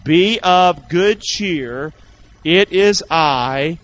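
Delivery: slow, loud, drawn-out recitation of scripture; slow group recitation. Shown as a demonstration. Preacher leading the church in scripture, slow and loud. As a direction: lead the congregation word by word, slowly. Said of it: "Say this part with me".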